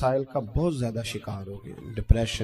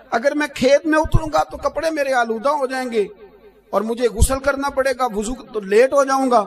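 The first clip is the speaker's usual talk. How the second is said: rising much higher and louder as the argument builds; preaching at full force; 10 semitones higher, 9 dB louder than usual. Retold or imitated. Shah insisting to the farmer that he must step down, in a building preacher's shout. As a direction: hammer home the religious duty, climbing in pitch.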